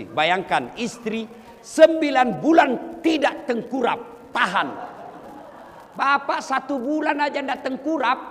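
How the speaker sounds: loud, high passionate appeal; loud preaching climax; fiery preaching. Real, not acted. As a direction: drive the point home to the policemen.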